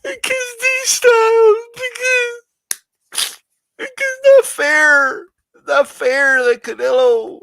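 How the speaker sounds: high, whining crybaby fan voice; mock whiny hater. Streamer mocking boxing fans who complain, high whining. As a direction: whine like a sore loser. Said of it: "you wanna cry about".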